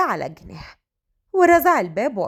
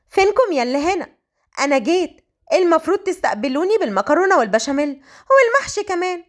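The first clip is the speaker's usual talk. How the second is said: high, lively homecoming call; playful daughter; 6 semitones higher than usual. A narrator's reading. Malak calls to her family on coming home, playfully demanding. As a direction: announce yourself brightly with higher pitch.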